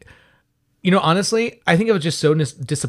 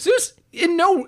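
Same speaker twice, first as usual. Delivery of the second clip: incredulous; slower than usual. Real, not acted.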